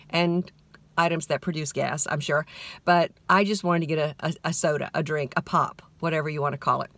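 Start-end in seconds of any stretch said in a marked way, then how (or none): none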